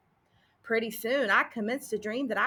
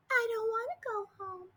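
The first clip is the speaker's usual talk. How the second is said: put-on higher, softer little girl refusing; orphan girl voice; 9 semitones higher, 5 dB quieter than usual. A narrator's reading. Little orphan girl refusing the sheriff, higher and softer. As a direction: pout it out like a stubborn little girl.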